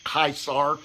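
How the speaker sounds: Caesar as KAI-sar, hard k; Latin pronunciation. Shown as a demonstration. Preacher showing how Romans said Caesar: KAI-sar. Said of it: "That's the way they would say Caesar".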